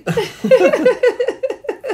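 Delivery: high real burst of laughter. Real, not acted. Laughing at the story.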